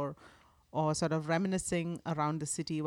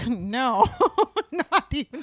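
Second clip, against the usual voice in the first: higher, louder, laughing no; laughing refusal; 8 semitones higher, 9 dB louder, slower than usual. Real, not acted. Guest asked to do an accent, laughing refusal. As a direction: refuse through real laughter.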